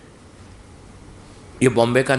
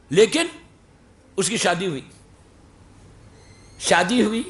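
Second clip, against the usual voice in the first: usual speaking voice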